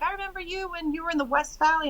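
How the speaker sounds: imitating her, high-pitched and eager; retold greeting pitched well up; excited greeting. Another lady recognising her from long ago, excited. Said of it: "She's like".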